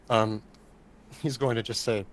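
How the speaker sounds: ordinary delivery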